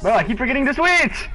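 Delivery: frustrated shout. Real, not acted. Frustrated at himself.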